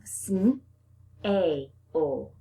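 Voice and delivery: Sn-a-o with each sound drawn out; split apart. Shown as a demonstration. Teacher breaks the word apart for the children. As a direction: stretch Sn-a-o into separate sounds. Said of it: "Break apart that sn".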